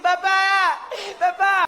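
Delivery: high mock child cries; terrified little boy. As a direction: cry out high like a frightened kid, hammed up.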